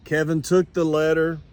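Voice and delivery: ordinary delivery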